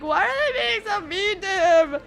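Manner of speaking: high, drawn-out whiny wail; mock drunk crying. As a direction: imitate a weepy drunk, slow and high. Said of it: "I'm just crying 'cause I'm".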